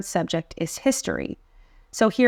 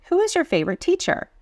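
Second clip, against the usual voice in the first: question tune; 10 semitones higher, faster than usual. Shown as a demonstration. Reading quiz question aloud.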